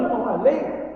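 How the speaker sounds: ordinary delivery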